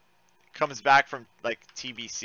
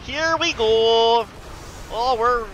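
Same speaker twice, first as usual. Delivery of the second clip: slow, louder and higher; drawn-out announcement; 8 semitones higher, 7 dB louder, much slower than usual. Real, not acted. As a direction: stretch it out like a hype call. Repeats phrase louder, slower.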